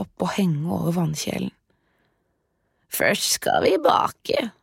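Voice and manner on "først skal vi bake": high, slow sly whisper; sly witch. Acted. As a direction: whisper it with a hidden plan. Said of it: "sa trollkjerringa".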